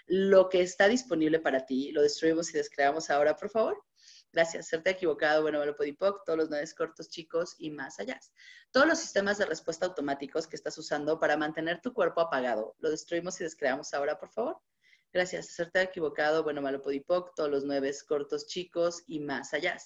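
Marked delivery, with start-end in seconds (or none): 1.9-8.2 smooth, practised litany of words; ritual clearing recitation. Acted. Facilitator reciting the clearing statement to her audience, steady chant. 8.7-19.8 murmured rapid litany; fast, soft clearing recitation. Acted. Facilitator rushing the clearing statement to her audience, soft and fast.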